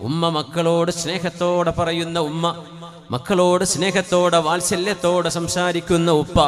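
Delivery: melodic sermon chant, sustained and projected; intoned religious sermon delivery; sing-song preaching. Real, not acted. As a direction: preach it in a lilting, drawn-out chant.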